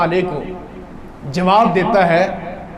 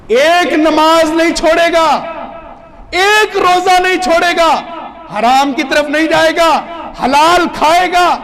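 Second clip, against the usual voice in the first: fervent listing; 9 semitones higher, 6 dB louder than usual. Retold or imitated. Listing imagined pious vows.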